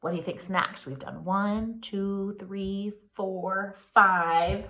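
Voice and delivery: ordinary delivery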